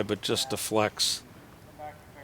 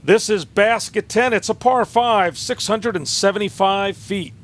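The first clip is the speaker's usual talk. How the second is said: higher, louder announcer read; 9 semitones higher, 7 dB louder than usual. Real, not acted. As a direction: announce the hole. Loud announcer setup.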